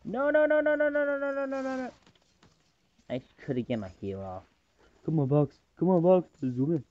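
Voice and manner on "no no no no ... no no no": real alarm fading out; panicked no's. Real, not acted. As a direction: cry out in panic as it falls apart. Teammate about to die.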